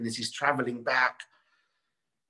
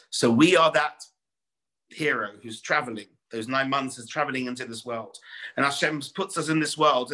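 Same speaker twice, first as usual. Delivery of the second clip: raised, fervent sermon voice; preaching; 6 semitones higher than usual. Acted. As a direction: drive the lesson home to the congregation. Preaching the parable's lesson.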